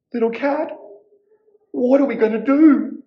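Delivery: high little witch, voice rising into a plea. A narrator's reading. Little witch asks her cat what to do, high-pitched. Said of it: "The little witch said".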